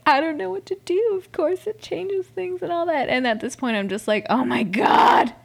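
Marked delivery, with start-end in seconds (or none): 0.1-3.1 high-pitched imitation of a torn woman; JoJo. Podcaster imitating the torn Bachelorette, high and helpless. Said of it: "JoJo's like". 4.3-5.3 loud, drawn-out mock outburst; overwhelmed exclamation. Fan reliving the shock of the episode, exclaiming loudly. Retold overwhelmed reaction to show.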